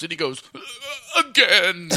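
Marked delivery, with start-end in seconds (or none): 1.2-1.9 put-on whimpering toddler sob, slightly raised; mock sobbing. Fallen toddler in uncle's arms, hammed-up tearful plea. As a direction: choke it out like a crying child. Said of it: "And he's sobbing in my arms".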